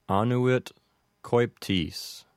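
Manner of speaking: annuit stressed on the first syllable, AH-noo-it; Latin annuit coeptis. Shown as a demonstration. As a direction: say annuit coeptis slowly, AH-noo-it KOY-ptis. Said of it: "pronounced this way".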